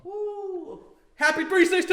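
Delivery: high, loud celebratory woo; wrestling woo. Acted. Host cheering a wrestling holiday with a high loud yell. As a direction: yell it like a ringside crowd.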